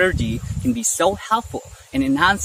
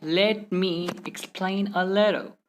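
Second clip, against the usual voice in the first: slow, "Let" and "me" fully separated; word-by-word; 4 dB quieter, slower than usual. Shown as a demonstration. Teacher showing the slow way to avoid, word-by-word. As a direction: pause between the words. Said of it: "Instead of saying".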